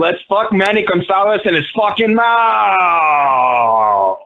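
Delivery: slow, drawn-out shout; bellowed sign-off. Acted. Host closing out the Dong Copter talk, bellowed sign-off. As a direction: holler it out, stretching the last word long.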